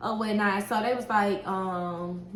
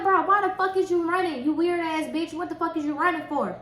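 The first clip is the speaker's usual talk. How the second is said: higher-pitched, exasperated demand; heated retelling, pitched up; retold anger; 6 semitones higher than usual. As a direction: re-enact a mad, incredulous challenge. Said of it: "I was so fucking mad".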